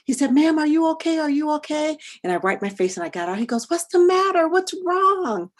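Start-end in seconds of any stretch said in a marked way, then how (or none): 0.3-2.0 raised, higher voice asking in concern; concerned neighbour checking on a crying woman; concerned man. Retold or imitated. 3.7-5.5 much higher, louder worried asking; concerned neighbour asking what's wrong; concerned man. Retold or imitated.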